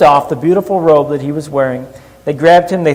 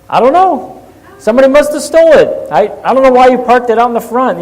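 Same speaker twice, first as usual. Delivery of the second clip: fake innocent kid; 7 semitones higher, 5 dB louder than usual. Kid lying about the stolen bike.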